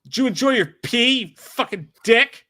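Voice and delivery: mock insult.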